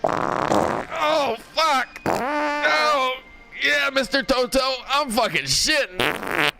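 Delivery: high, drawn-out mock groaning; hammed-up strained cries, high and slow; straining on the toilet. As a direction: play-act straining, moaning through the words. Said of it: "Meanwhile, I'm sitting there".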